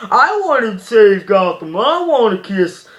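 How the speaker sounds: mock whine with drawn-out, swooping words; whiny moaning. Reviewer mocking Robin's complaints in the movie, whiny moaning. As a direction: imitate a bitching, moaning sidekick. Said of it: "he's just bitching and moaning".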